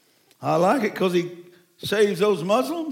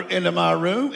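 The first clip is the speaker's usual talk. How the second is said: ordinary delivery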